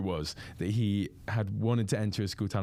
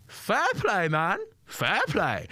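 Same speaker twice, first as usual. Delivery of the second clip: much higher impersonation, falling on fair play; James Acaster impression on request; 10 semitones higher, much slower than usual. Comedian asks for it again, heckler repeats in high mimicry. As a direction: do the comic's voice again, high and dry. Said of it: "Do that one more time".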